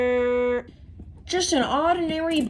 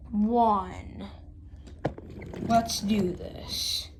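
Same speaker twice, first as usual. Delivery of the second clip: ordinary delivery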